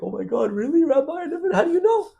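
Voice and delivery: very high, squeaky retold voice with a laugh; imitating the emotional man. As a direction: play the overwhelmed man, voice cracking way up. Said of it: "The guy started crying".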